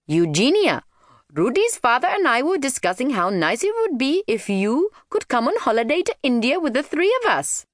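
high, lilting mum voice; friendly mother figure, inviting. Acted.

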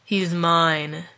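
understated, menacing demon voice; dark, possessive demon gloat; demonic warrior. A narrator's reading. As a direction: claim your prize darkly. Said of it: "called the demonic warrior".

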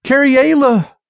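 high, drawn desperate call; belted out. A narrator's reading. Thief searching for missing companion, belted out. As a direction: call the name out high into emptiness. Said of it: "he belted".

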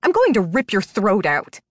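contained shout of a furious threat; enraged, forceful threat; angry shout. A narrator's reading. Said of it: "she shouted".

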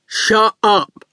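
slow, daft lout; strong daft voice. A narrator's reading. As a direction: speak slowly and strongly, like a big dim thug. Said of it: "He spoke in a strong, daft voice".

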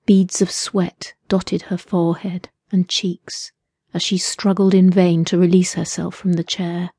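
plain reading voice

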